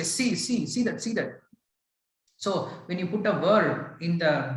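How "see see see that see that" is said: high and soft, urgent repeats; hushed alarm. Shown as a demonstration. Demonstrating instant fear at a snake, high hushed urgency. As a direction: point and hiss the warning. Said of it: "Immediately you will say".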